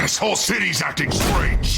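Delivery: sudden furious shout back at Bruce; angry outburst. Acted. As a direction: lash out at the accusation. Harvey snaps back at Bruce.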